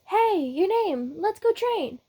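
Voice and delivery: high-pitched call to come train; brash training partner. Acted. Brash rival calls out in story.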